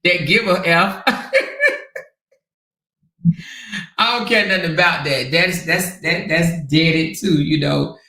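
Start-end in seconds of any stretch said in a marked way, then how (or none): 1.1-2.0 high-pitched giggle; squealing giggle; high laugh. Real, not acted. Laughing at the comments.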